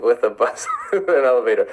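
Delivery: breaking into a laugh mid-line. Real, not acted. Host repeating a listener's Denmark joke, laughing. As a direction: repeat it and crack up halfway through.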